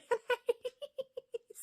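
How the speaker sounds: high laugh breaking into the line; burst of laughter. Real, not acted. Laughing at how sweet the mayonnaise tastes in her own retelling, high burst of laughter. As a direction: laugh out in disbelief.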